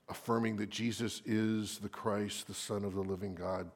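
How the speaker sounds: ordinary talk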